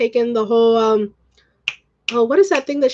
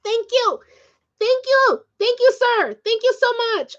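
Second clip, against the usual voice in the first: high, excited thank-yous; overjoyed thanks to a gifter; gushing thanks; 10 semitones higher than usual. Real, not acted. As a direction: gush with gratitude, voice way up. Thanking viewer for gift.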